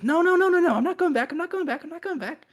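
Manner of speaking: very high, frantic protest; high-pitched panic while being dragged away; panicked succubus. Acted. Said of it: "you see she gets pulled down".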